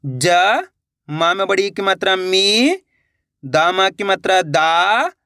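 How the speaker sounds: name each vowel sign, then stretch "मी" and "दा"; teacher sounding out "जा… मी… दा" with pauses; letter-by-letter pronunciation. Shown as a demonstration. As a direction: spell it out, holding "जा", "मी" and "दा" long. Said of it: "एक अक्षर का उच्चारण करके".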